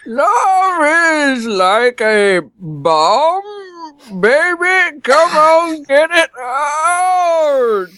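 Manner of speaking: put-on high, very slow crying old man voice; grumpy old man. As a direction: wail the lyrics like a sobbing old man. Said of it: "I'll do it in old man voice".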